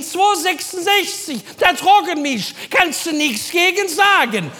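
hoch und gedehnt gerufen; Helga von hinten. Retold or imitated. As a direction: von hinten quer durch den Laden rufen. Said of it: "Helga von hinten". (ruft gedehnt und hoch von hinten)

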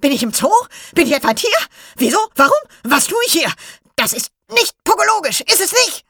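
very high, loud squawking creature voice; shrieking Pogo. Acted. Pogo the blue ball shrieks in confusion, very high and loud. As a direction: screech it out like a confused little gadget-creature.

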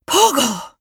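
high, loud startled outcry; surprised blurt. Acted. Anton blurts out the name in shock, high and loud. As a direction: it slips out before he can stop it. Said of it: "entfuhr es Anton".

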